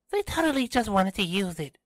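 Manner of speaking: high-pitched indignant exclamation; exclaimed. A narrator's reading. Kiara snorts at Tristan's argument, exclaiming. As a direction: narrator pitches her voice way up to protest. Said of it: "she exclaimed".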